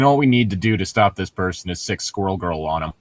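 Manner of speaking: ordinary talk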